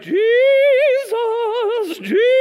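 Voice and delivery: high, loud, drawn-out wail of a name; calling to wake him. Acted. Disciple trying to wake sleeping Jesus, high drawn-out calling. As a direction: call out in mock panic, stretching it. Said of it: "How did you wake him up?".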